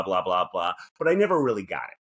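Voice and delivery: ordinary delivery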